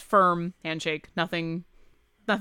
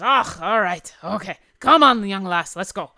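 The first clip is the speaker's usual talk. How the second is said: gruff dwarf, a touch louder; 6 dB louder than usual. Acted. Dwarf agrees to escort the girl, gruff and hearty. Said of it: "the dwarf says".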